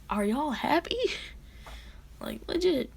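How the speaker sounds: high-pitched, pointed questioning; exasperated challenge. Retold or imitated. Hero to his scolders after removing faceplate, exasperated. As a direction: put it to them, pitch climbing.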